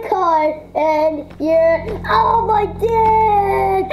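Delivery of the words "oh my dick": drawn-out, higher, louder yelp of pain; mock pained cry. Son hit by dad's car, hammed-up pained howl.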